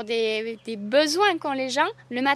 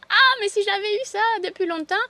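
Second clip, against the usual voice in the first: imitating her friend's excited cry, higher; mock relieved outburst; relieved exclamation; 9 semitones higher, 5 dB louder than usual. Best friend with period pain discovering the tea, excited. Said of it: "m'a dit".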